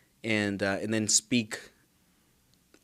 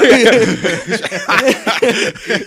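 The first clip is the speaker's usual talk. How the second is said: high, loud extended laugh; long laugh; 12 semitones higher, 13 dB louder than usual. Real, not acted. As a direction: keep laughing loudly.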